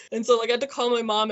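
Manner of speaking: ordinary delivery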